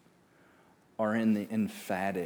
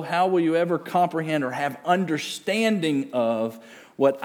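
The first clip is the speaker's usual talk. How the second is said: high, loud volley of questions; challenging interrogation; 7 semitones higher, 6 dB louder than usual. Acted. Preacher voicing God questioning Job's understanding, challenging. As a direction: press hard with piled-up rhetorical questions.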